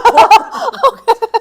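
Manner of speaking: high loud burst of laughter. Real, not acted. Recalling the scary seniors, bursting into loud laughter.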